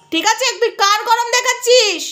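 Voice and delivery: normal talking voice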